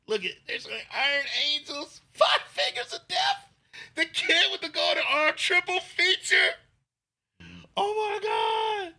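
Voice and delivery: high, loud, shouting out the film titles; yelling with excitement over the lineup; excited hype. Real, not acted. Hyping a kung fu film lineup.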